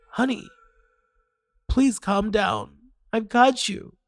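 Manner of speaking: soothing mother. Acted. Mother soothing her child.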